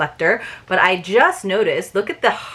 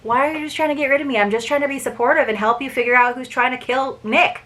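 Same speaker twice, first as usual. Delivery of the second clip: fast, higher-pitched mock protest; Hastings, complaining; 5 semitones higher, faster than usual. Narrator's buddy asks why he's being sent away, mock-protesting. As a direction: rattle off a put-on wounded complaint. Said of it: "was like".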